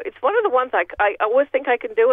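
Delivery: ordinary delivery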